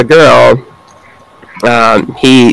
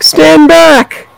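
put-on kid shouting. Kid charging the snowman.

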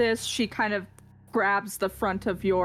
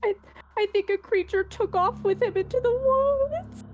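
high, tremulous voice of a desperate mother; sobbing mother; 12 semitones higher than usual. Acted. Grieving mother telling the party of her missing son, high and weepy. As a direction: plead through tears for the lost son. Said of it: "She goes".

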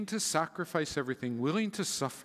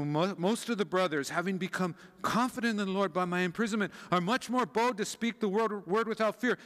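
excited reading, higher voice; excited cold read; 6 semitones higher than usual. Acted. First reader reading Paul's letter to his friends, excited. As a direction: read it aloud with rising excitement. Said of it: "must have been really excited".

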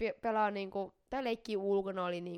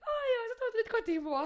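mom's voice, laughing; 13 semitones higher, 9 dB louder than usual. Retold or imitated. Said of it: "Sit äiti tuli".

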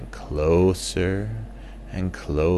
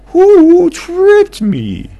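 high, loud owl character; squeaky owl friend; 16 dB louder, much slower than usual. Acted.

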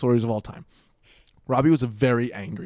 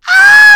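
very high, loud imitated scream; terrified shriek; 22 dB louder than usual. Friends hear a terrified scream from Robbie's room, very high and loud. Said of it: "the highest shriek we've ever heard".

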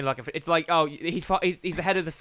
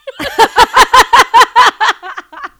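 big high burst of real laughter; loud laughter; 17 dB louder than usual. Real, not acted. Guest cracking up after reading a tweet, loud real laughter.

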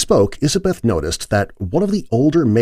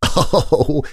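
chuckle; 9 semitones higher than usual. A narrator's reading. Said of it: "Bertha chuckled".

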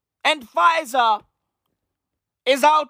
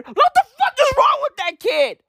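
high-pitched comic outrage; exasperated outburst; 11 semitones higher, 4 dB louder than usual. Acted. Comedian fed up with annoying TV son, high exasperated cry. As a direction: explode in mock exasperation.

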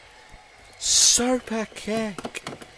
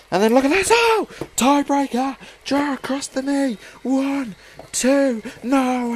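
excited shout; 5 semitones higher than usual. Real, not acted. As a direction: shout it as the move lands. Sudden big move called out.

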